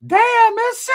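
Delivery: high, loud mock catcall; startled inmates crying out at the sight of Monster; catcalling Southsiders. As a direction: squeal it out like guys hollering at a girl. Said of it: "this is what I heard".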